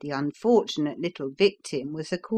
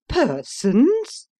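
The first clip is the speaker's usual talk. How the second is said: high, loud shocked question; outraged exclamation; 7 semitones higher, 6 dB louder than usual. Acted. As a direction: spit the word back in disbelief. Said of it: "ejaculated Missus Taunton".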